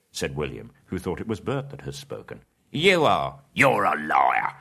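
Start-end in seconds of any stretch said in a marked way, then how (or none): 2.7-4.5 louder troll retort; quarrelling trolls. A narrator's reading. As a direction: toss the insult back like a squabbling troll.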